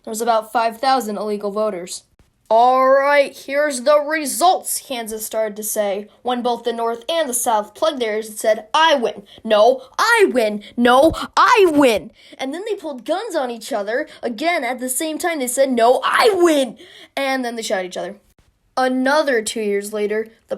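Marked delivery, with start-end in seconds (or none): 2.5-4.8 loud, slow announcer opening; announcing. Acted. Kansas begins announcing election results, announcing. 8.7-12.1 loud, slowing, growing more insistent; out-shout the rival with each repeat; escalating squabble. Acted. North and South plug ears and argue, escalating squabble. 15.7-16.8 loud, slow, emphatic claim; insistent squabble. Acted. North and South draw guns and argue, insistent squabble. Same quarrel at gunpoint.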